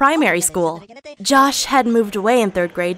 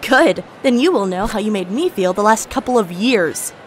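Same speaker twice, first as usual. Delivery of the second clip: ordinary delivery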